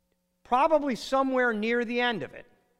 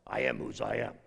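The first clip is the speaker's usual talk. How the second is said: much lower, solemn divine voice; voice of God; 11 semitones lower, 5 dB quieter than usual. Acted. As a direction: intone it as God speaking from the bush. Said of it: "it's not Popeye talking there".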